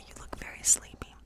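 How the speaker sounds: ordinary talk, nothing marked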